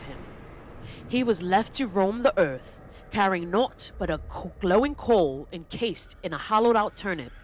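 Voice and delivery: put-on theatrical storyteller in a loose British accent. Telling the Jack-o'-lantern legend, theatrical loose British accent. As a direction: tell it grandly in a British accent, pausing between phrases. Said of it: "I did, like, a loose accent".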